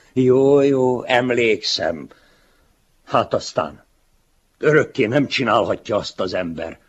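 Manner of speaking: loud, high, mocking lecture to his younger self; condescending older man. Older writer mocking his younger self, loud and patronizing. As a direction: talk down to the boy, loudly.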